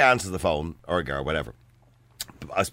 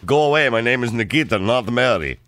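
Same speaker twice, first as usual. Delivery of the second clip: dismissive mock Russian; fake Russian accent; 2 semitones lower than usual. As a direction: brush them off in a Russian accent. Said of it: "I tried pretending to be Russian".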